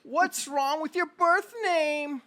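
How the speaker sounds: high, slow kvetching mother. Acted. Mother complaining to the caller, high and slow. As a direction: drag out the guilt trip. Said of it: "She goes".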